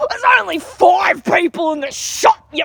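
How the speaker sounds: high, loud, drawn-out angry customer; hammed-up aggressive yell; mock furious shout. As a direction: rant like an outraged customer. Angry customer ranting, acted out.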